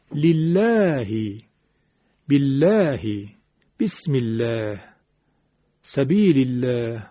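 لله، بالله، بسم الله، سبیل الله slowly, with a light L and fronted 'eh'; thin lam. Shown as a demonstration. Teacher giving examples after kasra, thin lam. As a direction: keep the L of الله thin after the 'i' sound.

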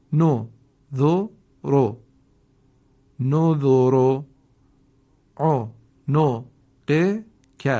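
letters spelled out loudly, then blended; loud teacher drill, letter by letter; spelling drill. Shown as a demonstration.

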